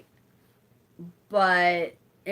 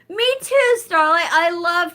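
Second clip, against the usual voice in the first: much higher, louder happy reply; excited; 9 semitones higher, 8 dB louder than usual. Real, not acted. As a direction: answer with delight. Reacts to viewer comment.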